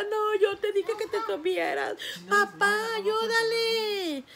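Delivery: high, near tears, asking dad for help; a son begging his father to step in, slow; pleading voice. Retold or imitated. Her son urges the friend's dad to help, high and tearful.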